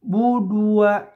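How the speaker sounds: Budua with lips pushed forward on the waw; rounded waw. Shown as a demonstration. Teacher showing the rounded waw. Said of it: "harus dimonyongkan bibirnya".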